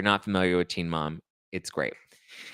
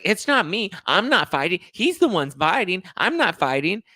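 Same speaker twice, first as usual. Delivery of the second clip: high, whiny imitation of denial; mock defensive protest; 10 semitones higher, 4 dB louder than usual. Mother denying to her daughter she's fighting, hammed-up protest. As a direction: shift the blame in a pitched-up voice.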